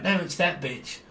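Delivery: sneering put-on blamer voice; mocking whiny quote. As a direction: mimic someone pointing fingers. Mocking people blaming others.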